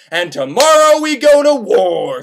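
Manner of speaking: loud, drawn-out mock battle cry; rallying proclamation. King of the Bulgars to troops, rallying proclamation. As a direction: proclaim it slowly to a whole army.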